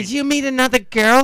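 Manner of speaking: pry like a nosy mother; suspicious mum. Pretend. Bullet's mum suspecting he met another girl.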